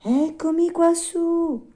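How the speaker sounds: very high, drawn-out little voice; tiny cricket voice. Acted. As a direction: call down slowly as a tiny cricket. Hidden cricket answers from above.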